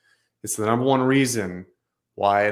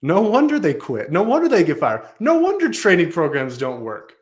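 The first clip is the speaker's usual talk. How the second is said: emphatic; 6 semitones higher, 6 dB louder than usual. Real, not acted. Emphatic rhetorical build.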